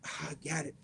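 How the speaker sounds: very soft, low, relieved breath of discovery; quiet realization. Retold or imitated. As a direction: murmur it to yourself as it clicks. Reenacting the moment of discovery.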